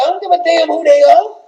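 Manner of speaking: offended wife ignoring the dignitaries, mock indignant; angry Michelle. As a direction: demand your due like an offended first lady. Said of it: "Michelle said".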